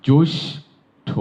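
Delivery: জ্যৈষ্ঠ drawn out slowly as joishtho; slow demonstration. Shown as a demonstration. Teacher drawing out জ্যৈষ্ঠ for students. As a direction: say জ্যৈষ্ঠ slowly, each sound clear. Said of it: "তারপরে জ্যৈষ্ঠ".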